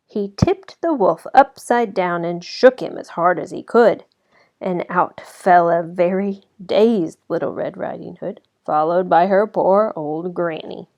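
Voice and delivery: usual speaking voice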